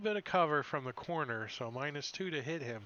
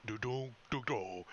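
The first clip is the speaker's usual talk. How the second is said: dopey brontosaurus. Acted. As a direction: hum along like a dim giant. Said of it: "the Brontosaurus is like".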